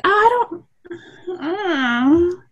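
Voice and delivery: high, wavering, lightly voiced I don't know; a mild, teary-sounding mock of a friend; unsure reply. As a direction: imitate someone flustered and unsure. Voicing someone's unsure reply.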